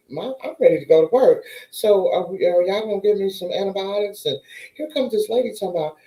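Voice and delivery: ordinary talk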